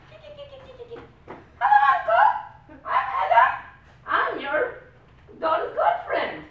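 very high, loud, odd little voice; squeaky humanoid. Retold or imitated. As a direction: chirp it like a tiny robot. Small humanoid greeting the father.

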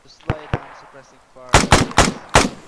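loud, slow drawn-out order; cover me shout. Acted. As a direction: yell over the gunfire.

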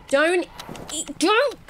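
high, loud, broken-off warning; alarmed warning. Real, not acted. Player sees fish about to be stolen, alarmed warning. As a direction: cry out to stop them, cutting off. Threatened by creatures in game.

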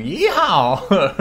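put-on long, loud cowboy yeehaw in an American accent; hammed-up US cowboy accent; mock American accent. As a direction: draw out a caricature American cowboy whoop. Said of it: "try to do a U S A accent".

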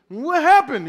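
lightly voiced kid asking his brother; curious little boy. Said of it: "little boy".

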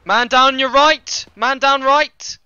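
slow, high, loud drawn-out shout; urgent battlefield call. Pretend. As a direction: shout the casualty warning to your squad. Squad leader warns of casualty.

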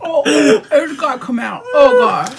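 disgusted outcry. Real, not acted. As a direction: cry out in disgust after a bad taste. Spitting out licorice, gagging.

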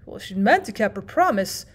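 usual speaking voice

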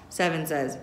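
usual speaking voice

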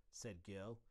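ordinary delivery, nothing marked

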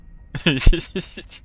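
high, loud giggling burst; loud laugh. Real, not acted. Player laughs at a game they don't understand, high loud laugh.

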